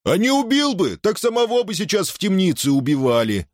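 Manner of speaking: высокий голос из толпы; выскажись на всю толпу высоким голосом; громкая реплика. A narrator's reading. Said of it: "громко высказался кто-то". (громко, во всеуслышание)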